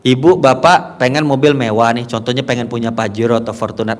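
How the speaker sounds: normal talking voice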